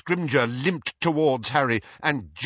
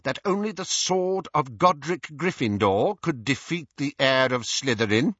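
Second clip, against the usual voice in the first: lightly voiced Minister, higher, probing; pressing Minister; 6 semitones higher than usual. Minister challenges Harry over the sword, pressing. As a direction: question him weightily, stretching the final words.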